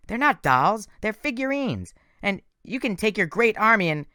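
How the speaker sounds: high, hurt protest; indignant boy. Acted. Nico protesting to Thorne that they are figurines, high and indignant. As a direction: correct him, offended.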